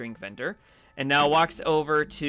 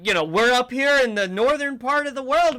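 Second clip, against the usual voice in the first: higher and louder, chirpy shopkeeper welcome; high jolly vendor welcoming a customer; cheery elf snack vendor; 6 semitones higher, 4 dB louder than usual. Acted. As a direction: greet the stranger like a jolly festive elf.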